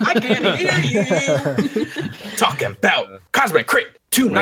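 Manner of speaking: slow, high, laughing MC call; a mock hype-man call with a hand to the ear; imitating hype man.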